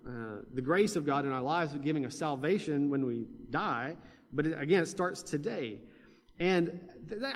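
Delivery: ordinary delivery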